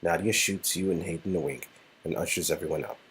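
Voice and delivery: usual speaking voice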